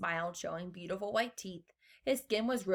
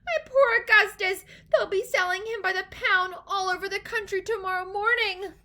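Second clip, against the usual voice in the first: high, loud wailing lament; screamed; 12 semitones higher, 7 dB louder than usual. A narrator's reading. Mother fears he'll be sold by the pound, screamed. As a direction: wail for your poor child. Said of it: "screamed Missus Gloop".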